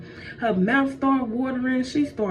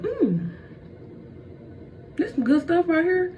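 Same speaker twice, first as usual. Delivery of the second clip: high, relishing "mm" and praise; savouring delight; 7 semitones higher than usual. Acted. Eve tasting the forbidden apple, delighted and high-pitched. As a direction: chew on it and coo with pleasure, high up.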